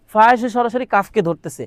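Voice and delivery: normal talking voice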